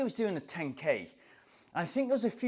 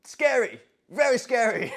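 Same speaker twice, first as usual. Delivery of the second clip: mock scared; 7 semitones higher, 7 dB louder than usual. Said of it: "And I was like".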